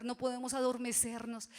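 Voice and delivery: normal talking voice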